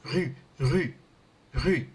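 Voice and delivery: "rue" with a rounded French u. Shown as a demonstration. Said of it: "become like rue".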